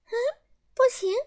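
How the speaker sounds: high teasing fairy voice; grinning elf refusal. A narrator's reading. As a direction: refuse with a cheeky grin. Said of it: "小精灵咧开嘴笑了笑".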